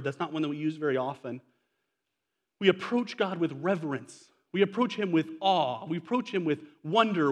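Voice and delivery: ordinary delivery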